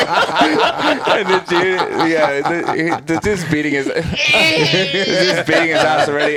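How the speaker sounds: high, loud laughter through the words; laughing hard. Real, not acted. Man cracking up over punching his friend's leg, laughing. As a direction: laugh helplessly while telling it.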